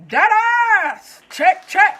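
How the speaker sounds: high, very loud hype shout; cheering him on; excited shout. Real, not acted. Ogling a character.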